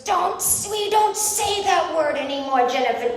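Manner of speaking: high, loud admonishing dad; mock-appalled father telling off his daughter; scandalized scolding. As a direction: shut the word down in exasperation. Dad scolds her over a word.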